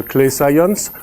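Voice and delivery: normal talking voice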